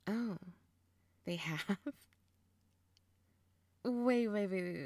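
ordinary delivery

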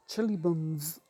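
best attempt at a New Zealand accent. Shown as a demonstration. Slang teacher shows how New Zealanders say it, New Zealand accent. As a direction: put on a New Zealand accent, vowels squashed. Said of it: "That's my best New Zealand accent".

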